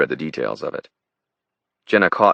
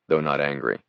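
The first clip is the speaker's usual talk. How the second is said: plain reading voice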